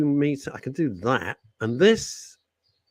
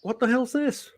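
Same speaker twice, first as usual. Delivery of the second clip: startled outburst; 11 semitones higher than usual. Retold or imitated. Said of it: "I'm like".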